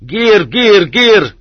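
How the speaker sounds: high repeated cries; yelling. A narrator's reading. Kazakhs chase along the bank after the boat, yelling. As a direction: whoop it out, pitched far above normal. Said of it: "along the bank yelling".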